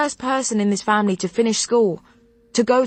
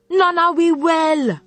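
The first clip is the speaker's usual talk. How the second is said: a Jamaican grandmother laying down the law; thick Jamaican patois; 6 semitones higher, 3 dB louder than usual. Acted. Grandmother forbidding granddaughter's therapy, thick angry Jamaican patois. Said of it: "My grandmother's accent had become so thick".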